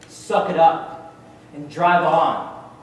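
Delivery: slow, drawn-out military shout; drill command. Acted. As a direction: bellow it like a Marine drill order. Marines drill command acted out.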